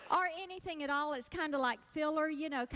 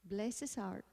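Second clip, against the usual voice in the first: softer, mock-sympathetic Southern "Bless his heart"; 5 semitones lower, 6 dB quieter than usual. Shown as a demonstration. Comic demoing Southern sympathy, soft mock pity. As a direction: coo it with false pity. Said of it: "we offer a very sympathetic".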